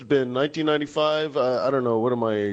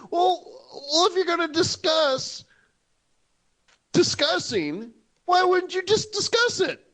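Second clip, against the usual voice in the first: high-pitched comic voice; hammed-up high incredulous voice; mock questioning. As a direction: squeak it out in mock bafflement. Mocking policymakers who only discuss.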